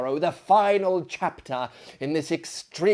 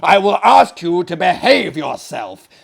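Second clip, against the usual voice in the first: angry host; 4 semitones higher, 13 dB louder than usual. Acted. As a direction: scold her sharply and loudly. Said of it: "interrupted our host very angrily".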